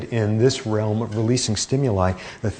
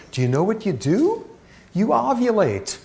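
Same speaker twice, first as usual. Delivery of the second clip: amazed reveal; 10 semitones higher than usual. Real, not acted. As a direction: pitch jumps way up for the punchline. Punchline of ovulation reveal.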